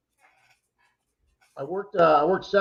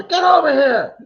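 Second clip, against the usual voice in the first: much higher and louder, a father barking at his son; mock father shout; 11 semitones higher, 9 dB louder, slower than usual. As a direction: yell for the boy from across the water. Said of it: "I hear the father".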